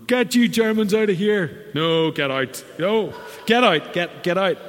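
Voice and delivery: loud, high hammed-up yell to get out; a preacher hamming up a rude outburst at tourists; mock angry shouting. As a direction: pretend to chase them out furiously. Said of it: "I'm not going".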